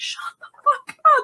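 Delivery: very high-pitched yelled silencer; acted-out yelling. Sergeant silencing officer, high-pitched yell. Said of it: "yelling back at him".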